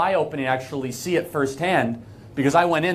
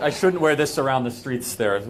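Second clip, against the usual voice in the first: usual speaking voice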